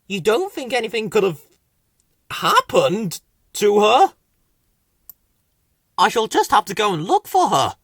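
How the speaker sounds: anxious little creature voice; Whoops. A narrator's reading. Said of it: "said Whoops".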